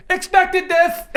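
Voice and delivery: dramatic hero line. Acted. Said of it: "I simply say".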